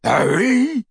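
high-pitched taunting echo; mocking mimicry. A narrator's reading. Crabbe taunts Harry by copying Ron, mimicking. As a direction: mimic his friend's call in mockery. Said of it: "mimicked Crabbe".

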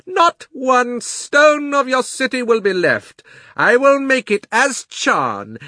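slow, loud, high bell-like proclamation; clear, exultant witch. A narrator's reading. Witch threatening to destroy the city, clear and exultant. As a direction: ring out like a great bell, almost happy. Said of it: "clear like a great bell and sounding as if".

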